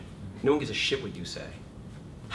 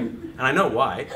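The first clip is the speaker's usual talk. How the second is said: ordinary delivery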